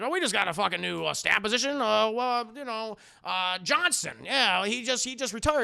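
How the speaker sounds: acted boss thinking out loud; blustering boss. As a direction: bluster as if improvising an announcement. Voicing the blustering editor.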